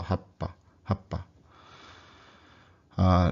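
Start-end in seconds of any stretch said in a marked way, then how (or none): 0.0-1.2 "happa" with the p held quite a while; long held p. Shown as a demonstration. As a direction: stretch the stop in "happa". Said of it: "hold it for quite a while".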